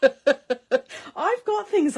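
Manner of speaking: slow, loud words through laughter; laughing. Real, not acted. Laughing about royal stamps.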